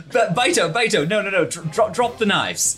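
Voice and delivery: alarmed pleading. Acted. Pleading with armed kobold.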